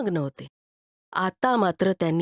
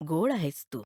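low, soft गोड आहेस तू; tender praise; 4 semitones lower, 4 dB quieter than usual. Acted. Man to the boy, low and tender. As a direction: say it quietly with tenderness.

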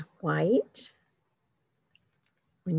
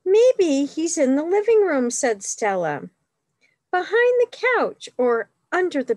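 put-on lively read-aloud for children, higher and louder; story reading; 6 semitones higher, 6 dB louder than usual. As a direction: read it like a picture book to kids. Reads picture book aloud.

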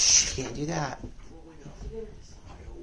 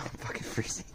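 whispered and much softer; freezing whisper; 10 dB quieter than usual. Real, not acted. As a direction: hush it out in the cold. Freezing outside at night.

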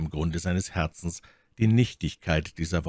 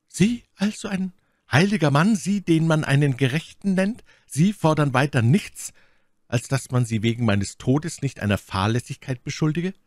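high-pitched righteous indignation; heiliger Unwille; 8 semitones higher, 4 dB louder than usual. A narrator's reading. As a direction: throw it at him in holy outrage. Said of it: "einem heiligen Unwillen nicht widerstehen". (mit heiligem Unwillen, entrüstet)

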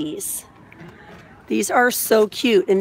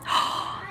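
delighted gasp; 8 dB quieter than usual. Real, not acted. Spots the mermaid dolls.

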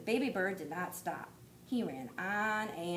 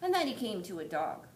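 plain reading voice

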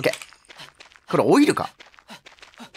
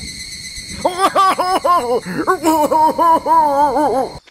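long cackling laughter fit; howling laugh; 6 semitones higher than usual. Real, not acted. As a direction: laugh helplessly at the absurd puzzle.

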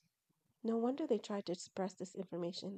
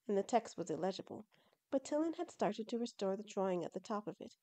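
ordinary delivery

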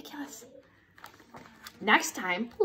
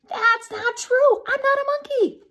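indignant protest; 10 semitones higher, 5 dB louder than usual. Acted. Reader denies the book's monkey claim, high indignant protest. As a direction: protest loudly at a silly accusation.

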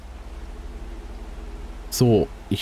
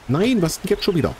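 exasperated cry; 7 semitones higher than usual. Real, not acted. Attacked again, exasperated.

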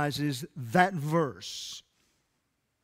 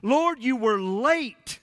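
higher, louder, slow aching plea; hurt complaint to God; 6 semitones higher, 4 dB louder, slower than usual. Acted. Believer voicing disappointment to God, aching plea. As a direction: cry out like someone let down. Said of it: "where you were just saying it".

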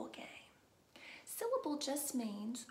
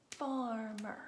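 "Farmer" loud, in two measured beats; syllable-by-syllable demo; 5 dB louder than usual. Shown as a demonstration. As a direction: sound out "Farmer" as FAR-MER. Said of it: "you can also do it like this".

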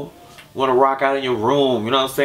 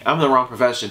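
usual speaking voice

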